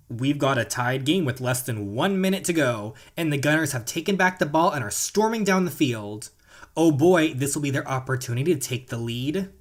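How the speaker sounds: excited play-by-play sportscaster; sports commentary. Acted. Gamer calling the final minute for viewers, excited play-by-play. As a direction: call the tied match like a live announcer.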